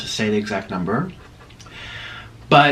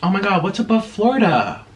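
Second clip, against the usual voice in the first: ordinary talk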